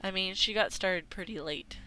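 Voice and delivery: normal talking voice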